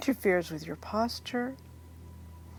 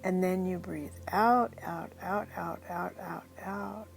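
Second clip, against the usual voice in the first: guided hypnosis; 5 dB quieter than usual. Acted. Leads a guided hypnosis induction.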